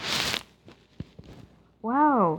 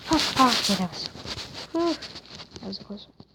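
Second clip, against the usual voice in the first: ordinary talk, nothing marked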